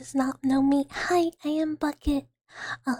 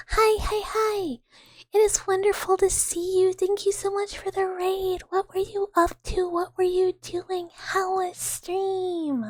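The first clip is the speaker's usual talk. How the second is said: high, drawn-out happy squeal; excited greeting; 6 semitones higher than usual. Real, not acted. Streamer welcoming Flopsy's raid, high excited greeting. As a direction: greet them in a high, gushing sing-song. Greeting a friend who raids.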